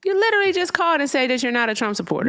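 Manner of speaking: fast, high-pitched exasperated protest; exasperated insistence. Real, not acted. Host argues with denying caller.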